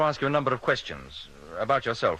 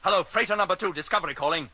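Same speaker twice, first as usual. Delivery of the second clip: high, raised radio hail; urgent calling; 6 semitones higher than usual. Acted. Captain hailing silent freighter, urgent calling. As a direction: call out to a silent receiver.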